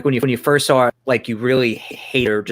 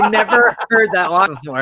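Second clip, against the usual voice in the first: laughing; 6 semitones higher, 3 dB louder than usual. Real, not acted. Laughing at a funny movie line.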